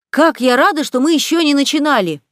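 high, loud glad exclamation; lively. A narrator's reading. Jo agreeing to give breakfast away, with lively eagerness. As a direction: burst out with eager gladness. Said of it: "Джо с живостью сказала".

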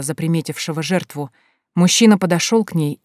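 plain reading voice

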